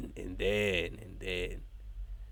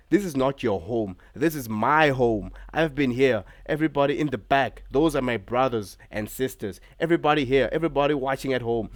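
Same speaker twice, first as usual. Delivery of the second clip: a hint of a taunting wrestler, mildly raised; Seth Rollins promo; 5 semitones higher, 7 dB louder than usual. Vlogger retelling Rollins addressing Punk, lightly voiced taunting promo. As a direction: lightly play the cocky champion on the mic. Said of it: "Seth Rollins said".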